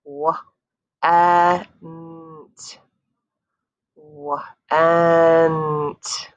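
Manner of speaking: slow segmented word; separate segmented sounds. Shown as a demonstration. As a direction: break each sound apart slowly. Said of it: "four separate sounds".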